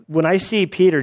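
ordinary delivery

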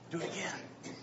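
whispered do it again; soft unvoiced urging. Shown as a demonstration. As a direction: hush it like a secret nudge. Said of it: "whispered urging".

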